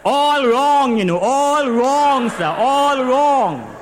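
slow exasperated wail; exasperated emphasis. Acted. Laments her bad gear changes.

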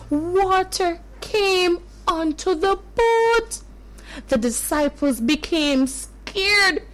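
usual speaking voice

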